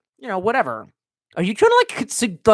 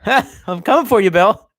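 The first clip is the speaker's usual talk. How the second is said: higher, louder, laughing into a mock threat; mock threat yell; 5 semitones higher, 7 dB louder than usual. Streamer yelling a hammed-up threat at Bill Gates, loud.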